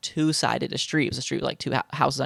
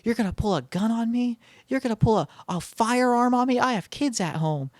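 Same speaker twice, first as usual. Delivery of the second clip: raised-pitch indignant protest, acted out; retold yelling; 8 semitones higher than usual. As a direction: imitate his outraged yelling at a contained volume. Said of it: "he starts yelling at her like".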